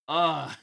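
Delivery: annoyed ugh. Real, not acted. Overwhelmed by loud game noise.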